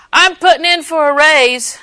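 loud, slow, high proclaiming; mock announcement. Secretary announces raise, retold.

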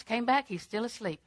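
ordinary talk

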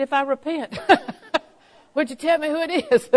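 laughing through a mock plea; desperate husband, laughing. Preacher retelling husband begging wife, laughing. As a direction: plead while cracking up. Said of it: "He's getting desperate".

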